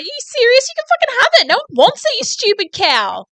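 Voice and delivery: high loud indignant yelling; retold outburst. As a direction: replay the fury at full tilt. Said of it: "I was like".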